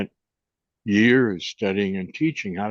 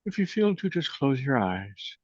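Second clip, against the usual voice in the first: guided meditation; 4 dB quieter than usual. Acted. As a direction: guide them gently into the breath. Leads a closing meditation.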